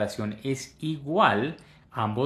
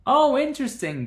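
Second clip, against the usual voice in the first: high exaggerated oh; 13 semitones higher, 5 dB louder than usual. Shown as a demonstration. Teacher modelling native intonation for the class, a high falling oh. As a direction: swoop way up on "Oh" and fall. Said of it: "Recuerden que en el inglés".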